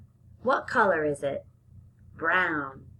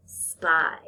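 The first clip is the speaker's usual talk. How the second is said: normal talking voice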